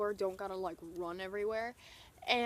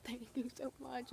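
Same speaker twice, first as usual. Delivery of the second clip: soft, mock-weepy thank you; mock tearful thanks; 11 dB quieter than usual. Fan thanking her idol's mom, mock tearful. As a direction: imitate hysterical thanks under your breath. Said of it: "crying hysterically".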